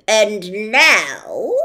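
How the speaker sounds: loud, slowish officious policeman; pompous policeman. Acted. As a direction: lay down the law with bluster. Policeman continues.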